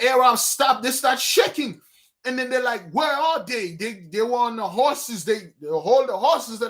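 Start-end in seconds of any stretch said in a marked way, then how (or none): 2.9-3.7 higher, frightened call of lost soldiers; startled bandit crying out in fear; panicked shout. Retold or imitated. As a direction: yell out in a panic, searching. Arabs shaking, can't see them.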